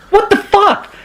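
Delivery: high incredulous what the fuck; mock baffled outburst; baffled disbelief. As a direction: stare at a crooked twig. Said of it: "expression on his face like".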